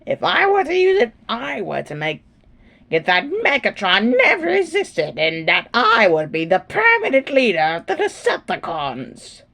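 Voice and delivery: high, nasal schemer voice; high screechy voice. Acted. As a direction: pitch it up and whine. High, shifted character voice.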